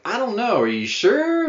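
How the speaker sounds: high-pitched, unsure mimicry; doubtful mock questioning. Lawyer and detective questioning her plan, imitated as high-pitched doubt. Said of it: "both her lawyer and the detective are just like".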